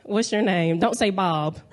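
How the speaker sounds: normal talking voice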